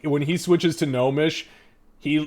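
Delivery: ordinary talk, nothing marked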